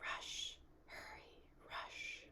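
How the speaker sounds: urgent, breathless whispering. A narrator's reading. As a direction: hiss the words like a voice in the dark. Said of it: "With the dark came the whispers".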